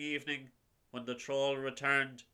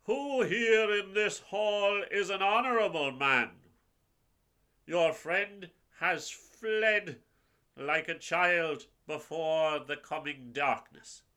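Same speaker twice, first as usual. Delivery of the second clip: higher, louder challenging troll voice; booming troll voice; 4 semitones higher, 4 dB louder than usual. Acted. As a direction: call out to the hall like a troll.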